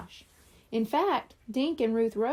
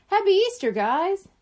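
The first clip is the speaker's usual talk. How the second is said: cheerful; 9 semitones higher, 6 dB louder than usual. A narrator's reading. Boy cheers the holiday.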